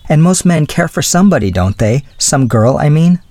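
lightly voiced young woman making excuses; flustered young woman. A narrator's reading. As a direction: lift the voice for a flustered girl.

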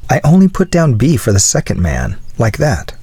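understated, apologetic plea; meek pleading. A narrator's reading. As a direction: justify yourself meekly. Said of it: "pleaded the philosopher, meekly".

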